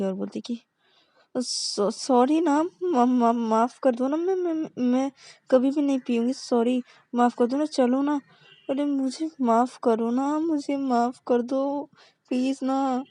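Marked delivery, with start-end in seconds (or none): 1.4-13.0 high, begging plea; pleading. Acted. Partner begging her girlfriend to forgive her. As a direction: beg her with a higher voice.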